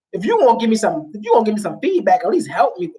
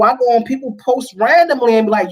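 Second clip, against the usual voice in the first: usual speaking voice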